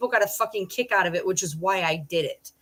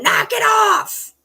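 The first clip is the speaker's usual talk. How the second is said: slow, high, loud shout; yelling at dogs; 14 semitones higher, 6 dB louder, much slower than usual. Retold or imitated. Her viral clip yelling at ten barking dogs, loud slow shout. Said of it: "of me yelling".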